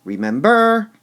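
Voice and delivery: rememBER, the BER high, loud and held; overdone final BER on remember; stress on last syllable. Shown as a demonstration. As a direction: wrongly punch the end of remember. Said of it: "Or would we say".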